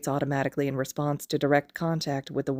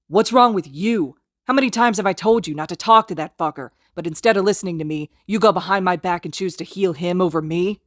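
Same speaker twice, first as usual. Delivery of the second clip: furious berating; 3 semitones higher, 6 dB louder than usual. Acted. Kacchan berates Izuku.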